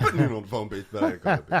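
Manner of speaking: cracking up hard on the punchline; laughing loud. Real, not acted. Host laughing loudly as he tops the phone call gag.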